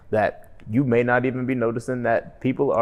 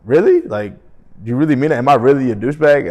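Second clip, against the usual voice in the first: a higher, louder, worried "Really?"; concerned disbelief; 3 semitones higher, 6 dB louder than usual. Retold or imitated. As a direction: ask in shocked concern whether it's true. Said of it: "I was really concerned".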